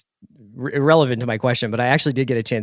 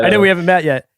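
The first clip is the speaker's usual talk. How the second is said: amused, higher and louder through laughter; laughing; 5 semitones higher, 5 dB louder, faster than usual. Real, not acted. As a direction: deliver the joke while laughing. Joking, both laughing.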